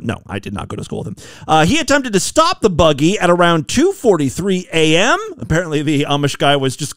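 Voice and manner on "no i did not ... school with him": low, quick, soft take-back; deadpan retraction. Real, not acted. Host takes back his schoolmate joke, deadpan. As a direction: drop it low and rush the admission. Drops the joke, admits the truth.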